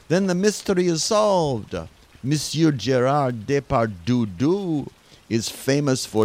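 put-on hammy French detective accent.

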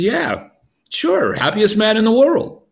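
high, bright, carefree claim; cheerful boast. Acted. Beggar tells king he is happiest man, high and carefree. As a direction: toss it off like it's obvious.

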